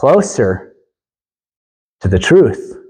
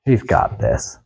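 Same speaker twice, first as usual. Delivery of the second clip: smug mutter; 8 dB quieter than usual. Retold or imitated. Smug echo, much lower and softer.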